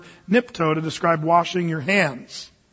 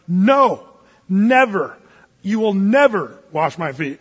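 louder, hitting "never" hard; flat no, then a firm "never"; stubborn Peter pushing back; emphatic refusal; 4 dB louder, slower than usual. Retold or imitated. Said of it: "He's being really emphatic".

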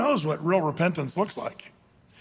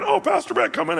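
welcoming host; 10 semitones higher than usual. Pretend. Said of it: "it'd be like".

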